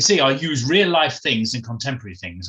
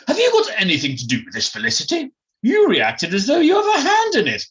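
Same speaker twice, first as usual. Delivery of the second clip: quick start, leaping higher and louder; accusing posh friend; 11 semitones higher, 5 dB louder than usual. Acted. Jemima accusing Felicity of having a hand in it, suspicious. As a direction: challenge her with rising suspicion.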